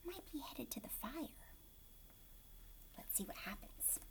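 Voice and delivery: put-on hushed whisper to the kids. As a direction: lean in and whisper the question. Whispered questions to children.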